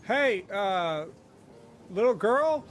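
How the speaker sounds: put-on distant boy calling. Halfling boy calls to the girl from far away, high and loud. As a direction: call across the beach to get her attention. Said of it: "in the far off distance".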